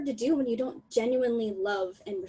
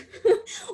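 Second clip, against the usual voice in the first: teary laugh; 12 semitones higher, 5 dB louder than usual. Real, not acted. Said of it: "I'm just happy and I'm crying".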